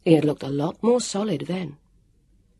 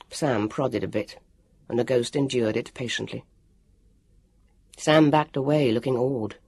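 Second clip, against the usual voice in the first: plain reading voice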